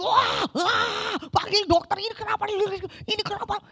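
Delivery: high, frantic cry for the doctor; panicked patient. Retold or imitated. Appendix patient panicking at the neighbour's groans, frantic high cry. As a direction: yell in alarm, then fade to worried.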